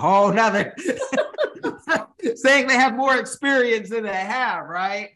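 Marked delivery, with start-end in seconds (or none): 0.8-2.3 a sudden high squeal of real laughter; shrieking laugh cutting into the sentence; high burst of laughter. Real, not acted. Laughing at in-laws' story.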